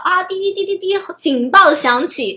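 high loud beeping alarm imitation. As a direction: beep like a warning siren.

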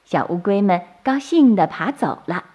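usual speaking voice

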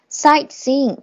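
SIGHTSEEING with a brief stop, t unreleased. Shown as a demonstration. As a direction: pause after "sight" without popping the t. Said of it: "一般是不完全爆破的".

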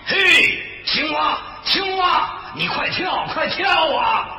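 loud shout across a river; calling out. Acted. Tiger calling the frog across the river, shouting. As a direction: holler for someone far off, urging them on. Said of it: "朝河那边喊着".

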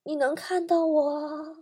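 slow, soft, drawn-out eerie voice; hushed, agonized haunting question; pained ghostly woman. Acted. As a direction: wail it slowly and quietly like a suffering ghost. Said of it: "她一脸痛苦说".

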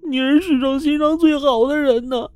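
高声哭喊，音调高亢; 抱着尸体痛哭着喊叔叔. Acted. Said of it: "小兔子是大声的嚎哭啊". (小兔子抱着老赵的尸体高声哭喊)

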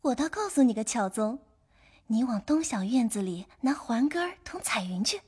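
put-on high, soft, giggly girl's voice; playful, high-pitched teasing; laughing reply. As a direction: start with a giggle, then tease lightly. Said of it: "笑道".

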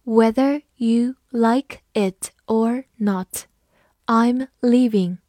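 slow, careful model sentence; slow reading. Shown as a demonstration. Teacher reading slowly for the class. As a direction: read it slowly, word by word. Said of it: "好，慢慢来读".

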